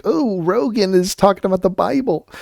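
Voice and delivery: high, soft, dopey fan impression; mock the excited fan. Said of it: "He's like".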